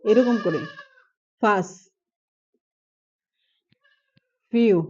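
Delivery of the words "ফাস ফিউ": ফাস and ফিউ each with a puffed ph onset; aspirated ph sound. Shown as a demonstration. Teacher demonstrating ফ sounds, aspirated. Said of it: "এভাবে".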